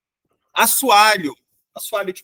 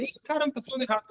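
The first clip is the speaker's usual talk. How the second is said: mocking correction; 5 semitones higher, 9 dB quieter, much faster than usual. Mock reaction to mispronunciation.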